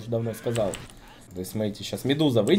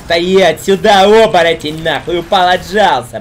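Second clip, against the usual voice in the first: loud, higher drill-sergeant yell; shouting commands; 6 semitones higher, 12 dB louder than usual. Acted. Barking orders at werewolf hero.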